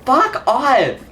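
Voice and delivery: angry. Real, not acted. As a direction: bark it in real frustration. Enemies keep attacking.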